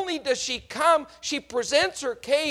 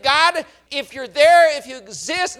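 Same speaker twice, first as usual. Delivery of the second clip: desperate prayer; 3 semitones higher, 9 dB louder than usual. Retold or imitated. Believer cries out to a silent heaven, desperate. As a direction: cry up to God at the end of your rope. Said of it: "you said".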